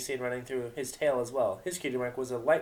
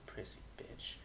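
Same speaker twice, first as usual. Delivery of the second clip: 1 whispered insult; 18 dB quieter than usual. Acted.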